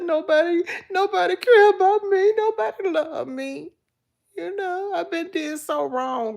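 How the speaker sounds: high, wailing mock lament; imitated crying. Retelling the movie mother's breakdown, imitated high crying. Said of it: "I cried when she was crying".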